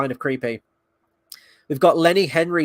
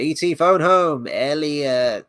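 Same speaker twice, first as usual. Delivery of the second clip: slow imitation of a stranded alien; mock alien movie voice; 4 dB louder, slower than usual. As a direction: croon it in halting broken English. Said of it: "E T's like".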